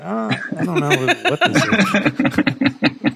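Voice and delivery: slow, laughing mock confusion; puzzled laughing quote. Shrugging off the snack with a laugh, slow and puzzled. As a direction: laugh through a baffled question. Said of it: "You're like".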